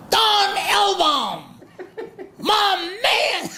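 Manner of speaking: high, slow, hammed-up shouted greeting; imitating a screaming Don King. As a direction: belt it out like a boisterous promoter. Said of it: "he's screaming at everyone there".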